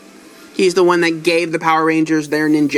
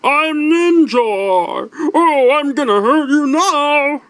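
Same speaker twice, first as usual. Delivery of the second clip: high, slow, goofy cartoon hero voice; cartoony Ninjor voice; 9 semitones higher, much slower than usual. Toy reviewer mimics Ninjor introducing himself, high goofy cartoon voice. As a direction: ham up a silly cartoon warrior announcing himself. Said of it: "that really cartoony voice that was like".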